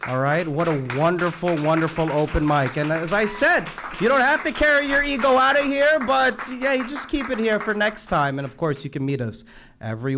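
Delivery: loud, high, rousing emcee voice; rally the whole room as you wrap up; building shout to a crowd; host's sign-off. Real, not acted. Host closes the open mic.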